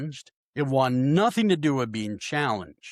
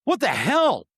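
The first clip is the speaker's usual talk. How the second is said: high, loud startled what the hell; startled friend; 10 semitones higher, 4 dB louder than usual. Retold or imitated. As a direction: blurt it out shocked, voice jumping up. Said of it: "He goes".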